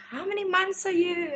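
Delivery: a worried mum pressing her daughter, up high; mom asking. Retold or imitated.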